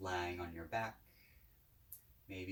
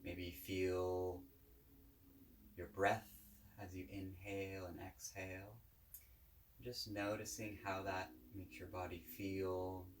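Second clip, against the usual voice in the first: calm, unhurried guided-meditation voice; 3 dB quieter than usual. Real, not acted. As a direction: guide listeners gently, with long pauses between phrases.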